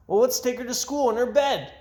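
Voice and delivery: put-on high, eager little boy; brother voice. A narrator's reading. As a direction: pitch it up like a kid brother with a bright idea.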